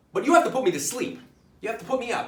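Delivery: high, loud comic pleading; urgent insistence. Acted. Patient demanding sedation from his doctor, urgent pleading. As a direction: insist on it as if desperate.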